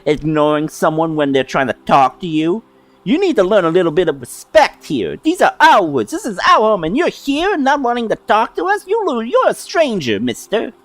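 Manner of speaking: put-on high childlike fairy, fast and indignant; 1 pouty scolding fairy. Fairy scolding the stranger for ignoring her, high and pouty. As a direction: scold him like an offended little kid.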